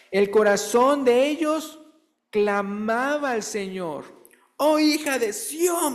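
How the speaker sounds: high, slow, impassioned reading; fervent scripture reading. Acted. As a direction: plead the verse slowly and high. Reads Lamentations with fervor. (lectura bíblica ferviente y lenta)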